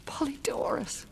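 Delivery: put-on high, breathy cry for her child; anguished fear. Mother realizing they will take her son, anguished fear. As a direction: fear rising in a thin, whispered voice.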